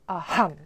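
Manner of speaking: AHAM with a punched breathy H; short A, forceful "ham" in "Aham"; aspirated H. Shown as a demonstration. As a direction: puff out the second syllable of "Aham". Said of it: "very important that you make an aspirate".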